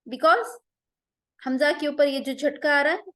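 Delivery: ordinary delivery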